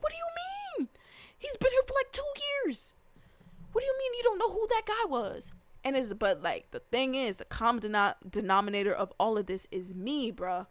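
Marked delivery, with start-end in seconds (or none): 0.0-5.5 much higher, disbelieving, fast at the end; incredulous outburst. Retold or imitated. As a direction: squeak it out like their ignorance is unreal. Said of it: "And I'm like".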